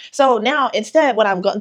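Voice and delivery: ordinary talk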